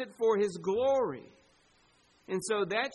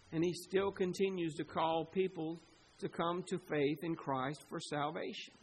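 normal talking voice

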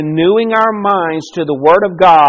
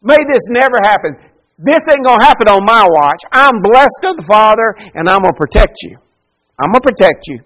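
Peter rebuking; 3 semitones higher, 5 dB louder than usual. Acted. Peter takes Jesus aside to rebuke him, loud and insistent. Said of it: "he rebukes him".

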